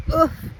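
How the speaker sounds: loud high oof of pain; pained gasp. A narrator's reading. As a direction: gasp out as the weight drops. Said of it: "gasped Maffin".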